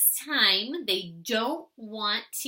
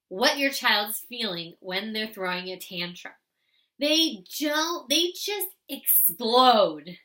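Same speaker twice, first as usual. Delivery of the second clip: ordinary talk, nothing marked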